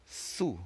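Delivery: su with rounded French u, lips pushed forward; French syllable su. Shown as a demonstration. Teacher showing students the sound su, soft and careful. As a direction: model su softly and clearly. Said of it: "S U is pronounced".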